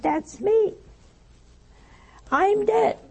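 high-pitched imitation of a startled man; astonished realization. Preacher retelling man seeing his own body, astonished. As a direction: act out a sudden, astonished discovery.

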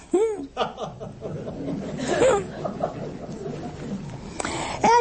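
airy high-pitched laugh breaking out; amused giggle at his own joke; high breathy laugh. Real, not acted. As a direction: let a light wheezing laugh escape.

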